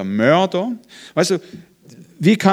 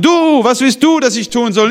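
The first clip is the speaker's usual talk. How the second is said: "Du" punched higher and louder to open the question; stress on Du; 7 semitones higher, 4 dB louder than usual. Shown as a demonstration. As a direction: lean hard on "Du", then let "was willst du" ride high. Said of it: "Das ist die Betonung".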